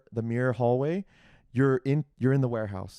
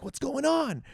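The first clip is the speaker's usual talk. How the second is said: much higher, startled family asking what's up; high, baffled question from the family; family's surprise; 13 semitones higher, slower than usual. Retold or imitated. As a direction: squeak it out like a stunned relative. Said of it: "And the family's like".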